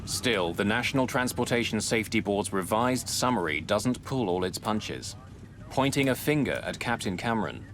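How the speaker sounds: ordinary talk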